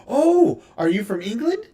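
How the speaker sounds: high, loud burst on "Oh"; mock excited recognition. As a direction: imitate an over-eager, clueless American. Imitating Americans mistaking an accent.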